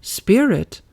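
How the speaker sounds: high startled echo; surprised exclamation. A narrator's reading. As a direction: repeat it in shock. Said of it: "exclaims the woman".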